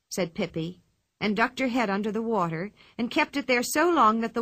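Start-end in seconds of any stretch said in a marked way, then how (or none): none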